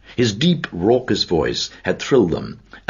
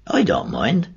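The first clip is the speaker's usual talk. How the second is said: nervous smile; 8 semitones higher, slower than usual. A narrator's reading. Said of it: "smiling a little nervously".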